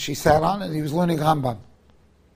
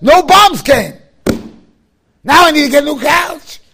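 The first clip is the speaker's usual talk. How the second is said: loud, high, slow mock outcry; loud exclamation; 9 semitones higher, 11 dB louder, slower than usual. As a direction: belt out the old man's indignant joke. Father's exclaimed retort.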